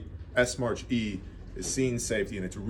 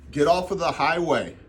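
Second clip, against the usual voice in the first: louder, higher command; raised-voice retold command; exasperated order; 6 semitones higher, 5 dB louder than usual. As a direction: re-enact ordering bystanders away. Retold order to wandering bystanders.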